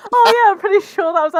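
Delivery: breathless laughing self-quote; laughing retold quote. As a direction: laugh through your own old certainty. Retelling her mix-up, laughing.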